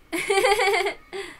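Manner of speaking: a short, scornful chuckle; dismissive little laugh; disdainful laugh. Acted. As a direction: laugh off the ghost story with contempt. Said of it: "Erica just laughed disdainfully".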